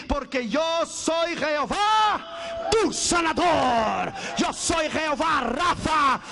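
slow, ringing shouted declaration; climactic preaching. Real, not acted. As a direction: proclaim each phrase with drawn-out force.

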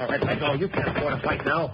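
high, strained warning mid-struggle; tense warning. Acted. Boatman fighting off a gunman, tense warning. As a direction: force it out high while grappling.

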